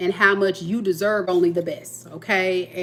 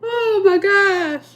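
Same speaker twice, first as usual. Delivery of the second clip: slow, high, laughed-out exclaim; high drawn-out laughing sigh; breathless oh my gosh; 11 semitones higher, 5 dB louder, much slower than usual. Real, not acted. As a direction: gasp it out after laughing. After laughing fit.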